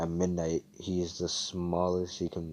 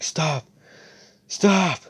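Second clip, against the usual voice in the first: loud pleading, much higher on the second; desperate shouts; 9 semitones higher, 10 dB louder than usual. Retold or imitated. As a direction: cry out for it to stop. Defeated foe begs lion stop.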